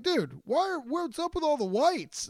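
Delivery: high, hammed-up bewildered asking; incredulous question. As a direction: squeak it out in mock disbelief. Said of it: "And I was like".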